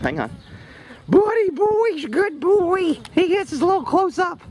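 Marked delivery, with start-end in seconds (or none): 1.1-4.4 much higher, louder, slow cooing. Real, not acted. Trainer coos to her horse for his close-up, high and slow.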